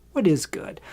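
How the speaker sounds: whispered aside. Real, not acted. Asking himself about the good parts, whispered. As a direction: drop to a whisper, musing. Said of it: "let me tell you what is good".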